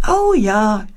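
put-on a child's happy cheer; joyful cheer. A narrator's reading. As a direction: cheer it out with delight. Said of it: "jauchzt Paulchen". (fröhlich jauchzend)

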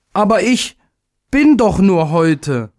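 high, slow, anguished protest; little day protests that he only lives today; desperate cry. A narrator's reading. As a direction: cry it out in despair. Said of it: "rief er".

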